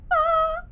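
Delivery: sharp high frightened scream. Real, not acted. Streamer sees a creature climbing, high scream.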